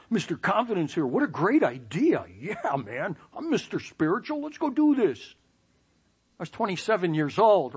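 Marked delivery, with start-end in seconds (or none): 0.0-5.3 high, hammed-up bravado; fake confidence. A nervous young man faking eager confidence to the boss. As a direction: oversell it like you're Mister Spiritual. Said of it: "Outward appearance, I go".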